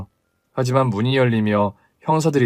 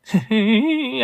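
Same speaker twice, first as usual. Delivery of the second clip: scornful laugh; 9 semitones higher than usual. Acted.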